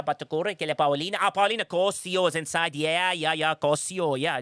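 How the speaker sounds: ordinary talk, nothing marked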